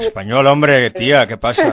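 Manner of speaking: loud, hammed-up Spain Spanish accent. Teasing the actress with a loud Spanish accent. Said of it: "you do the Spanish accent".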